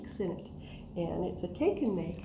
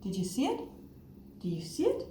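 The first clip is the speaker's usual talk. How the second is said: usual speaking voice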